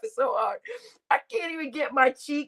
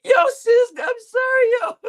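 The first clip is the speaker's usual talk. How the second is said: high, loud, laughing cry of sorry; laughing apology; 11 semitones higher, 5 dB louder than usual. Real, not acted. Streamer apologizing to sis, loud high laughing. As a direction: squeal sorry through laughter.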